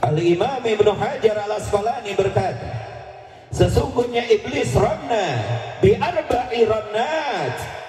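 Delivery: high, fervent sermon voice; full-force preacher declaiming; fiery preaching. Acted. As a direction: preach it out with rising fervor.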